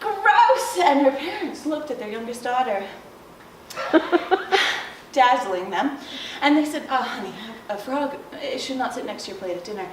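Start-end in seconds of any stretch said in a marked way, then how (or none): 0.0-0.7 high, whiny revulsion; disgusted sister. A narrator's reading. 6.9-9.9 low, soft chiding; gentle parent. A narrator's reading. Parents gently correct daughter.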